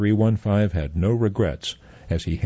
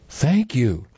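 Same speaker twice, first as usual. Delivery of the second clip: put-on higher, grateful little girl; sweet child; 6 semitones higher than usual. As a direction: thank him like a happy child. Girl thanking robot.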